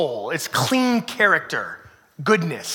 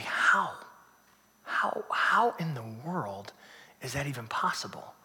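slow, whispered wonder; awed whisper; 6 semitones lower, 11 dB quieter, slower than usual. Retold or imitated. Congregant marvelling at quiet strength, whispered. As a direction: breathe it out in disbelief.

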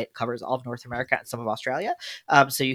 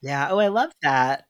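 ordinary talk

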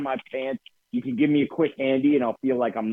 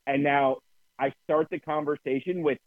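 normal talking voice

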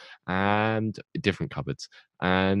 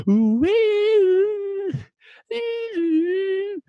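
very high, wailing "ooh"; imitated dramatic theme music; 6 dB louder, much slower than usual. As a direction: mimic an eerie TV theme sting. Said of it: "the music's like".

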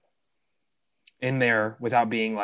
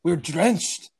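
higher-pitched, hammy stab at Scottish; attempted Scottish accent; 8 semitones higher than usual. Indy complaining to the butler, bad Scottish accent. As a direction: put on an indignant, badly faked Scottish accent. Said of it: "He adopts a Scottish accent".

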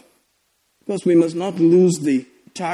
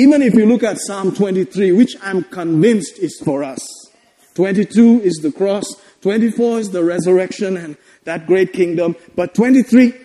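usual speaking voice

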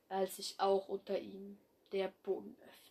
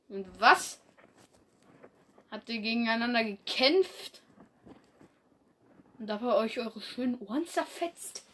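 mocking hiss; 7 dB louder than usual. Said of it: "fauchte Mondstern".

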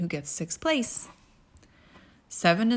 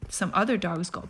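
ordinary delivery

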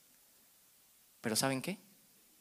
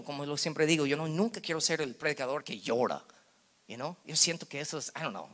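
ordinary delivery

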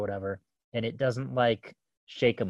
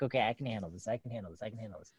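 lower, fading from soft to near silent; self-calming mantra; 3 semitones lower, 10 dB quieter, much faster than usual. Retold or imitated. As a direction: repeat it to yourself, quieter each time. Calming herself before confronting daughter.

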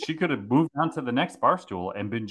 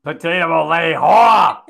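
much louder, playful mock accusation; mock yell; 3 semitones higher, 13 dB louder than usual. Real, not acted. Cohost teasing the host with a loud playful yell. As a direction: shout the tease in fun.

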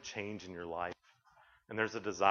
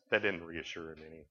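usual speaking voice

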